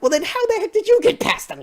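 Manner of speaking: a high mock shout of disbelief; incredulous outburst. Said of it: "I'm like".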